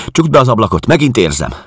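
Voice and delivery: high, loud, alarmed order; agitated demand. Acted. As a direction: demand it as if panic is rising. Helen urgently orders window shut. (riadtan, emelt hangon sürgetve)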